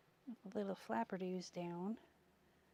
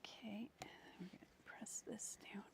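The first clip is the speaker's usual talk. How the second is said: whispering; 14 dB quieter than usual. Acted. Whispered crafting narration.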